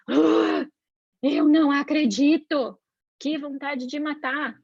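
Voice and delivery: groan, then drag the words out in acted fury; mock exasperation. Parent seeing the child's mischief, hammed-up exasperation. Reação exagerada do adulto, encenada.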